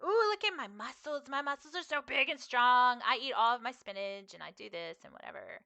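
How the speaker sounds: cutesy boast, starting high and loud; Griffin. Retold or imitated. Streamer imitating podcaster as muscly game character, playfully boastful. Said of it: "like, a big muscly character".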